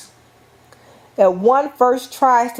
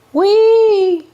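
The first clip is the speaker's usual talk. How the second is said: put-on high, loud, gleeful little-kid "Wee!"; playful child's cry of joy; delighted toddler squeal; 12 semitones higher, 5 dB louder than usual. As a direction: squeal it like a toddler with a new toy. Child playing with new toy.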